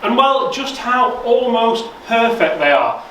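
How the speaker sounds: presenter voice. Acted. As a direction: sell it to the camera. Presenter retake.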